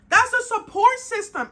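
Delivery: loud, high punchline; emphatic point. Real, not acted. Driving home her point to viewers, loud and high. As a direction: land it hard and proud.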